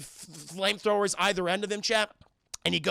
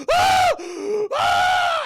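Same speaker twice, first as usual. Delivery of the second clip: two very high, loud shrieks; imitated scream; 9 dB louder than usual. Brett grabbed by the alien, high loud screams. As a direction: scream like a victim being grabbed.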